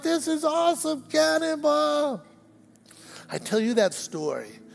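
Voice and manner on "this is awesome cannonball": high, softer mock yell; small-scale imitation of a gleeful boy; excited kid, held back. Young boy jumping yet again, excited mock yell. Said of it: "He'd go like".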